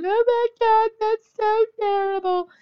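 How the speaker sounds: mock the horrified liberal in a shrill put-on voice. Mocking liberal listeners with a high, loud, shocked put-on voice. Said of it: "all you liberals out there going".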